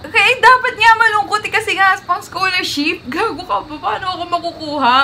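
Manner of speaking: loud, high, half-laughing protest; exasperated protest. Real, not acted. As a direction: argue back at full volume. Bickering over how to record plea.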